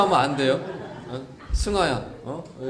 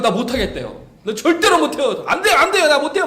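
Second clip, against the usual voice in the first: put-on higher, loud childish protest; whiny refusal; 7 semitones higher, 6 dB louder than usual. Jeremiah refusing God's call, whiny protest. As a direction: whine it like a scared kid refusing. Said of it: "막 이러는데요".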